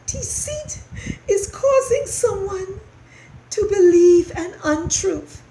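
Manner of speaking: high, tearful mouse voice; small, miserable squeak; unhappy little mouse. A narrator's reading. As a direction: explain it in a squeaky whimper. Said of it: "said the unhappy little mouse".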